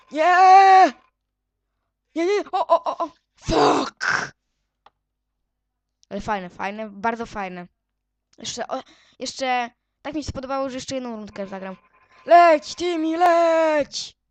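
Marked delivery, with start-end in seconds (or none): 0.1-4.3 loud high despairing scream; a shrill, loud real wail of defeat. Real, not acted. Player's character dies. 12.3-14.2 loud high drawn-out urging; will the little guy to fly; urgent shout. Real, not acted. Urging his character to fly.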